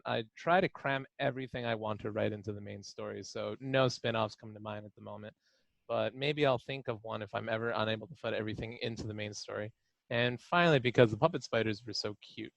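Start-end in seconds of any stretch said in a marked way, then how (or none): none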